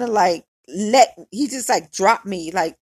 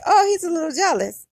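teasing; 8 semitones higher than usual. Retold or imitated. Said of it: "at first I thought it was cute".

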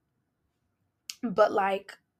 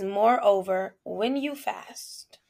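slow, solemn verse reading; serious reading; much slower than usual. Shown as a demonstration. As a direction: read it gravely, word by word. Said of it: "read it again in a serious note".

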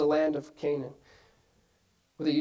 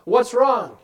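louder concerned call through the door; parent calling out; 5 semitones higher, 6 dB louder than usual. Retold or imitated. Parent at the child's door at night, calling louder.

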